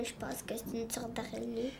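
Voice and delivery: timid, fast whisper; whispered guess. Real, not acted. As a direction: barely voice the guess. Shy guess.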